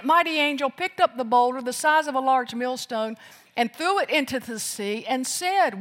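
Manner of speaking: ordinary talk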